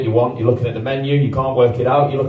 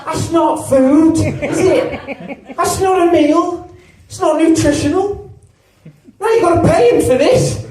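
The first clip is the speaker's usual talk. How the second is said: high, slow mock outrage; 10 semitones higher, slower than usual. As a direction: act appalled at the order.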